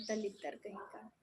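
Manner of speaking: a quiet scornful aside; muttered insult. Real, not acted. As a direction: spit it out quietly in disgust. Muttering an insult at a chat message.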